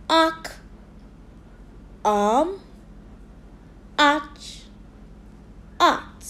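Arc, Arm, Arch, Art, each alone with an open "ah"; repeat-after-me drill. Shown as a demonstration. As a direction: model Arc, Arm, Arch, Art one word at a time. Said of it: "Repeat after me".

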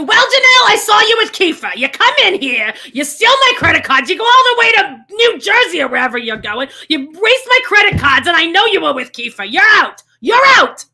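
high, full-volume scolding rant; hammed-up furious yelling, building to the end; Barb. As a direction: screech accusations like an outraged mother. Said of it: "do a Barb".

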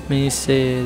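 ordinary talk, nothing marked

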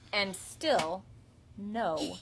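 ordinary delivery

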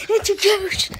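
high, loud pretend fright; mock scared shout. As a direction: cry it out in mock panic.

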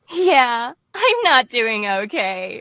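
slow, drawn-out character line; quoted episode line. Shown as a demonstration. Actor quotes the latest episode, slow and lightly acted. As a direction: draw out the admission slowly. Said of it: "the latest episode with the".

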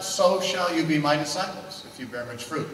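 usual speaking voice

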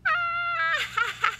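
high squeal of delight at own joke; shrieking laugh. Acted. Mouse shrieks with glee at its own joke. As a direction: burst out in a shrill laughing yelp.

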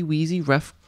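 ordinary delivery, nothing marked

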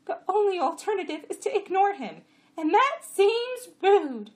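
high, loud donkey voice; answering jenny. A narrator's reading. Jenny explaining to the jack, high and loud. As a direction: answer high, stretching the end. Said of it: "Chamra answered".

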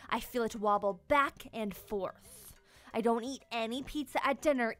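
plain reading voice

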